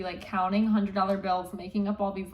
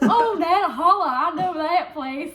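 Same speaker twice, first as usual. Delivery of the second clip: high, slow Southern drawl on holla; Southern accent; 9 semitones higher, 8 dB louder, slower than usual. Retold or imitated. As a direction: stretch it out like a Southern local. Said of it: "Like, this Southern guy".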